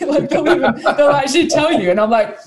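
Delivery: high, amused talk through laughter; tickled and giggly; laughing. Real, not acted. Laughing at kids' bluntness.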